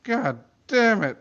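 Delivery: high angry cursing; shouting Vinny. Acted. Vinny shouts after shooting. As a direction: curse it out, furious. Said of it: "shouted Vinny".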